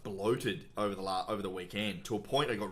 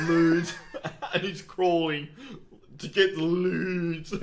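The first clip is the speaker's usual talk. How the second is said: higher, louder words through laughter; laughing; 8 semitones higher, 7 dB louder, slower than usual. Real, not acted. Cohost laughing about a film scene with his friend. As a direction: crack up while telling it.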